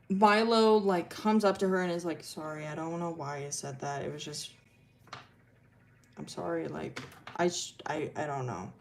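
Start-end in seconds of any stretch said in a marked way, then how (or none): 2.2-8.7 low, very soft, halting apology; retold sorry, mumbled quietly and low; sheepish apology. Milo apologizes to Arden the next day at school, sheepishly.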